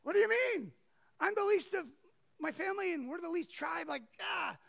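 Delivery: high, loud panicky protest; freaked-out protest. Retold or imitated. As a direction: cry it out like a cornered man. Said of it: "freaked out".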